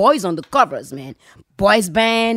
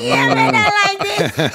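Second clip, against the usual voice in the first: gleeful mock cheer; 12 semitones higher than usual. Girl mocking the fake [name], shouting gleeful mock cheers. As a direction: cheer him on with mocking glee, voice soaring. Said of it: "I'm like".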